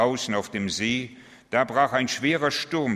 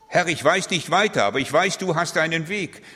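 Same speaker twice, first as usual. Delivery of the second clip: fervent prayer; 5 semitones higher, 4 dB louder than usual. Acted. Preacher prays aloud.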